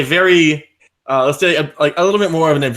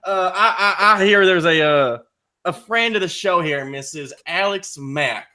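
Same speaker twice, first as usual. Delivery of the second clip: bad Southern accent; 2 semitones higher than usual. Character calling in to wish the couple well, in a bad Southern accent. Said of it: "deeply sorry to the entire South for this accident".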